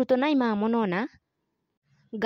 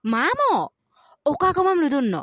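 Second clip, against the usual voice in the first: calling out; 4 semitones higher, much faster than usual. Acted. Calling out to uncle.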